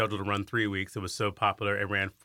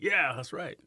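a playful, proud, higher retold boast; proud yeah; 7 semitones higher, slower than usual. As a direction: relive the crowd's cheer with a cocky lift. Cheering crowd, proud retold reaction.

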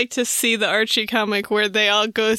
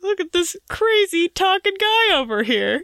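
playful mock Cockney voice, pitched up; 9 semitones higher than usual. Co-host mocks how people treat Cockney, in a mock Cockney voice. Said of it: "Cockney, you're like".

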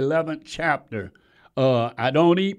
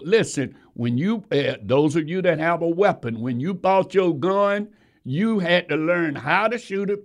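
usual speaking voice

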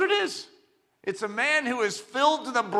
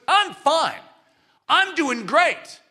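higher, louder mock brag; self-justifying man; 6 semitones higher, 5 dB louder, much slower than usual. Man boasting of his own goodness, mock brag. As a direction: puff up and insist you're fine. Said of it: "proclaim each his own goodness".

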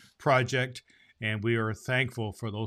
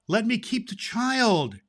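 high, pleading nymph; trembling plea; 9 semitones higher, 3 dB louder than usual. Acted. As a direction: beg for the child, a little shaky. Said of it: "she answered, beginning to tremble".

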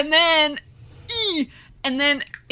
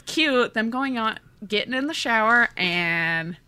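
ordinary talk, nothing marked

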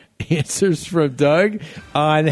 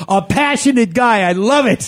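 higher-pitched enthusiastic approval; retold delight; 6 semitones higher than usual. Host recalls first seeing the promo, retold delight. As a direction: replay being thrilled by what you saw.

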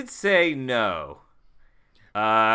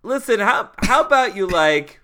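put-on higher, chummy pitch to an old pal; friendly, higher-pitched sweet talk; straight-up appeal to the house; 5 semitones higher, 3 dB louder than usual. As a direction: greet the house like a buddy, pitched up and coaxing. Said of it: "gonna be straight up with this house".

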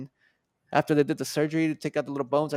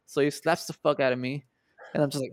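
ordinary delivery

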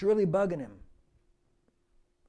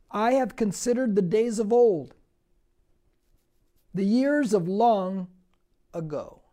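forceful scripture reading; 6 dB louder than usual. Acted. Preacher reading Psalm seventy-seven aloud to the church, loud and declaiming. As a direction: declaim it to the congregation.

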